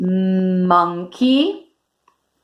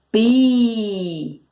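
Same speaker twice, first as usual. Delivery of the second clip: ordinary talk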